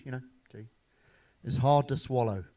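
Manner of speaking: ordinary talk, nothing marked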